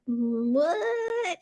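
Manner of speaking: confused what. Retold or imitated. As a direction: play dumb, totally lost. Her confused reply to husband.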